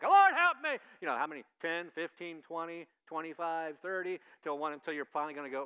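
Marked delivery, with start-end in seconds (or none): 0.0-0.8 sudden very loud, high yell; repeated shout. Shown as a demonstration. Preacher testing how long listeners will stay, shouting. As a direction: blast out the same plea to wear them down. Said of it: "how many times can I say that".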